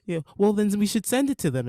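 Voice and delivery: mock kid's backtalk to a parent; child talking back. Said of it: "And then you say".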